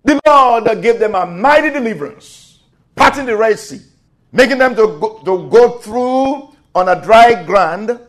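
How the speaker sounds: loud, high-pitched sermon storytelling; forceful preaching. Acted.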